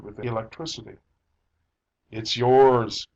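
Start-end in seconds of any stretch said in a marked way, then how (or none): 2.1-3.1 loud statement. A narrator's reading. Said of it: "he said to his wife".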